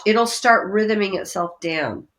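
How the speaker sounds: ordinary delivery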